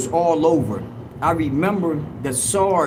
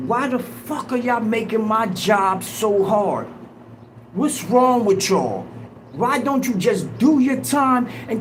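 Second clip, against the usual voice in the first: higher-pitched retold rant at the inmates; lieutenant yelling at the dorm, higher-pitched retold shouting; 6 semitones higher than usual. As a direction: replay the lieutenant's furious yell, pitched up. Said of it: "screaming at the top of his lungs like".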